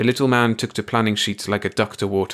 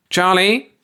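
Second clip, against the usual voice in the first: calling out; 11 semitones higher, 6 dB louder than usual. A narrator's reading. Boss calls across office.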